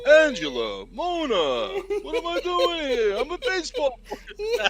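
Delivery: hammed-up high whiny sitcom character; whiny Tony impression. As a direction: whine it like a lost baseball player. Impersonating TV character whining.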